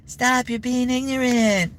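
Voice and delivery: very high, slow breathy pop-star voice; high and slow, South Park style; [name] impression. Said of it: "I just do the South Park version".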